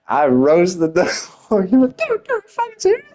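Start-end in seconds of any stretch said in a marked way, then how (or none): 2.0-3.1 very high-pitched mock outrage; imitated outrage. Tim getting so mad after being roasted, imitated in high mock outrage. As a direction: squeal it like a sore loser. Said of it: "He got so mad".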